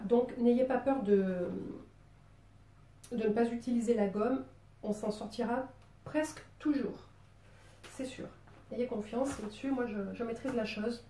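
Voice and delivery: ordinary talk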